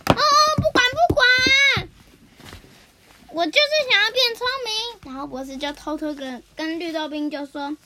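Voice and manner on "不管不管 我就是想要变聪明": put-on high, slow whiny child voice, much louder; a kid stamping and whining for what he wants; 撒娇的小孩. 绿豆冰撒娇要变聪明. (尖声拖长、大声撒娇的小孩)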